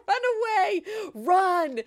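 high, loud playful cry to flee; hammed-up panicky urging, amused; laughing mock alarm. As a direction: call out a joking warning through laughter. Jokingly urging listeners to flee.

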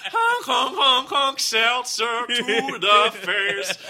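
loud, high, sing-song honks; clown honking. Acted. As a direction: honk like a clown, then slow.

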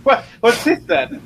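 very high, loud British bafflement; squeaky comic British accent; mock British. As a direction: echo the puzzled Brit, even sillier. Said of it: "British reaction to it".

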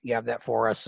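low, soft, scratchy character voice; halting, scratchy and low, with throat-clearing; scratchy Clint. Acted. Clint on a date with Miss Amanda, low scratchy voice. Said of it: "talking like this makes my voice kinda scratchy".

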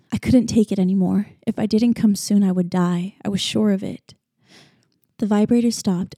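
ordinary delivery

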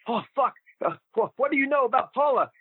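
mock taken-aback, high voice; Emile Dupre. Caller asked about his old girlfriend, mock startled. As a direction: imitate a man caught off guard. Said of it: "He goes".

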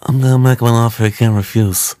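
a hint of a mumbled Godfather; lightly voiced Godfather, swollen mouth; Vito Corleone impression. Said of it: "Marlon Brando as Vito Corleone".

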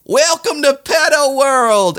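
very high, slow theme-park welcome; megaphone announcement. Retold or imitated. Nazis greeting the crowd, high slow announcer call. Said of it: "the Nazis turned to the crowd with the megaphone and goes".

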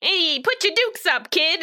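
put-on high, cocky street-kid challenge; old-timey scrappy kid voice; old-timey tough guy.

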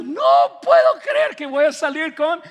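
high, loud, drawn-out fan excitement; hammed-up, high-pitched amazement; starstruck disbelief. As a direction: gush like a thrilled fan. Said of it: "Tú saldrías de este lugar pensando".

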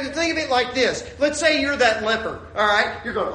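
ordinary talk, nothing marked

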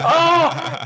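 high, loud, moved "Oh!"; a sudden high burst of tenderness; touched exclamation. Real, not acted. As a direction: let the heart melt out loud in one cry. Touched by her son's tearful words.

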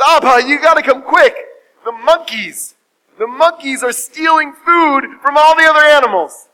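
high, loud, frantic report; urgent alarm. Acted. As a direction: cry out to father with hammed-up urgency. Son raises alarm third time.

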